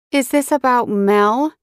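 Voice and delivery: a touch of probing suspicion; suspicious woman. A narrator's reading. McClintock questions Mac.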